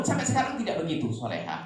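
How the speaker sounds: usual speaking voice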